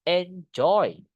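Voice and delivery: "Enjoy" with a strong, falling "joy"; stress on second syllable. Shown as a demonstration. Teacher modelling the syllables, stress on "joy" in "Enjoy". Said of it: "Penekannya di joy-nya".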